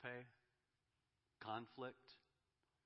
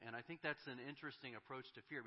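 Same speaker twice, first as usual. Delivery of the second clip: usual speaking voice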